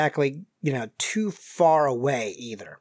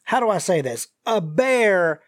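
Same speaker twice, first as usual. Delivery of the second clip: hesitant folksy Southerner, drawn out; stall, then deliver it with Southern colour; injured Southern stranger; 2 semitones higher, slower than usual. Acted. GM voices the injured man.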